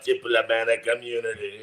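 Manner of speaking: put-on low, menacing accented villain line; movie villain impression. Quoting the Lethal Weapon 2 villain, low and menacing. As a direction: drop low and sneer it. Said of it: "The Kruger on".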